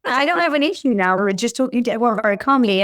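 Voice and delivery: ordinary talk, nothing marked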